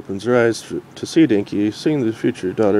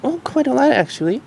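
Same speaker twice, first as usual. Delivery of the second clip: cute Future Derpy. Acted. Future Derpy telling the Doctor about Dinky, cutely. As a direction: say it with a hint of cuteness. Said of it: "with a hint of cuteness about her".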